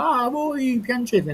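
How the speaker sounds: high, slow mocking whine. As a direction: mimic the critics, drawn out. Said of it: "quelli che dicono".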